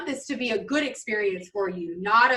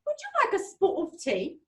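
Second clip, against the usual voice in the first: higher mock British accent; 7 semitones higher, 4 dB quieter than usual. Imitating Mom asking about tea, higher British accent. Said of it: "in a British accent".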